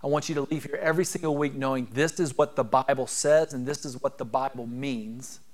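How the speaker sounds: usual speaking voice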